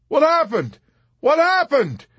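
high, loud, frantic shout; panicked cry. A narrator's reading. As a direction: cry out in shock, demanding to know. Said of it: "he cried".

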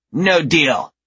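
put-on high, loud child refusal; bossy little girl. A narrator's reading. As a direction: refuse like a stubborn kid. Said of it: "the older one says".

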